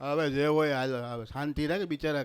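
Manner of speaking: pacify softly like a docile husband; mild mock-meek peacemaking. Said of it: "એનો ઘરવાળો પાછો ગાય જેવો".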